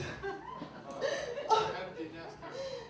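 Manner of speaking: high breathy laugh. Real, not acted.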